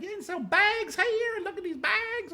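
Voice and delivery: slow, high, put-on girl's voice; mock shoplifter girl. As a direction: ham up the cheeky girl. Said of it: "She's like".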